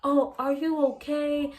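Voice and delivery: high, slow, fussing-over-you voice; mock concerned passerby. Imitating passersby asking the fallen girl, high and slow. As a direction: imitate a passerby sounding pitying. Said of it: "People don't walk past me and was like".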